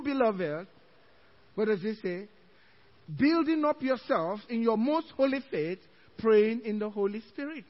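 usual speaking voice

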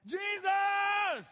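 a huge, high, full-throated yell; acted desperate yell. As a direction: cry out across the crowd. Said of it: "He was yelling".

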